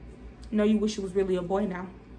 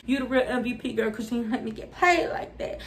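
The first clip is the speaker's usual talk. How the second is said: ordinary delivery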